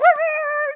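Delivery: a burst of high laughter. Real, not acted. Preacher laughing at his own dog story, high laugh.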